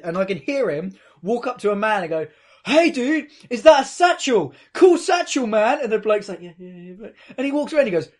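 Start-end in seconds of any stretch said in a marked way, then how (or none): 2.6-5.8 put-on higher, louder drunken hail to a stranger; drunk guy. Drunk friend accosts a stranger about his bag, loud high drunken imitation. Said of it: "He's wankered". 6.4-6.9 very soft, hurried placating reply; brushing him off. Retold or imitated. Stranger placates a drunk in the street, quiet dismissive mumble.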